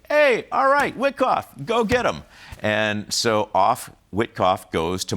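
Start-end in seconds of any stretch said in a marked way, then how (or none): 0.1-2.2 much higher and louder, breezy go-ahead; imitating Trump. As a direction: wave him off like a careless boss. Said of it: "So he's like".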